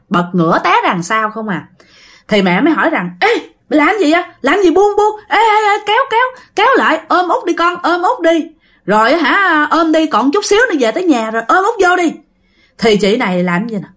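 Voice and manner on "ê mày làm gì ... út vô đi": high, urgent mother calling to her passenger; alarmed urging. Acted. Mother to drunk passenger letting go on the motorbike, alarmed. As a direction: call out in a high, startled rush.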